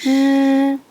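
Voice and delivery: 르 as a retroflex "r", tongue curled up. Shown as a demonstration. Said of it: "리을을 혀를 올리고 발음합니다".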